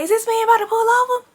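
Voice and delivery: high, fast incredulous question; can't believe he's driving on; disbelief. Retold or imitated. Passenger watching the driver keep going, incredulous.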